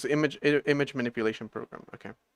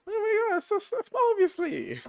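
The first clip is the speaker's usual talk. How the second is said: high, strained "oh my God"; high squeaky exasperation. Real, not acted. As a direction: squeal it out in disbelief. Realizing own mistake.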